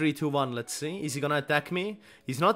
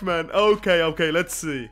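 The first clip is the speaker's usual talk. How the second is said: usual speaking voice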